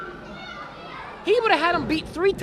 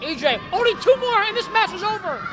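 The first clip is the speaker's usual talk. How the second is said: excited; 8 semitones higher than usual. Real, not acted. Cheering his wrestler near victory.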